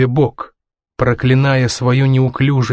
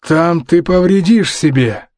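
slow, higher bark from the father; mildly barked, laboured warning; 5 semitones higher than usual. A narrator's reading. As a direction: warn him sharply but slowly. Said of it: "рявкнул Саймон".

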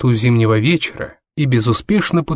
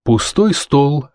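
plain reading voice

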